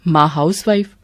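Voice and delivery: ordinary delivery, nothing marked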